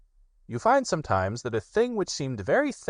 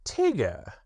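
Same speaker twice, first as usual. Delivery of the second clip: solemn Rabbit; 7 semitones higher than usual. Acted. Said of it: "he said solemnly".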